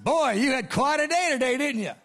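high, loud, amused teasing; imitated laughing remark. Preacher imitates Bob Jones at the back, high amused teasing. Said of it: "He's laughing. He said".

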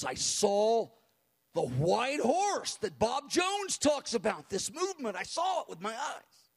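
high, emphatic imitation of an awed witness; dramatic testimony. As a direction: proclaim an astonishing sighting. Friend's awed vision report, retold.

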